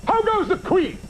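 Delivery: pompous Macbeth. Retold or imitated. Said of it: "The actor says".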